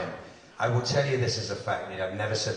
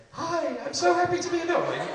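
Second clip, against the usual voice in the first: squeaky, much higher put-on voice; mock high-pitched greeting; 3 dB louder than usual. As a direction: chirp it far above your usual pitch. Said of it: "The voices get deeper as".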